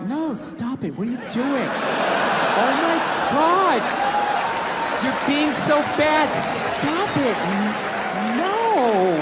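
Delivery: slow, swooping, teasing protest; coy fake refusal. Woman pretending it was his idea, coy fake refusal. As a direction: say no while plainly meaning yes, drawn out. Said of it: "that's not a fucking no".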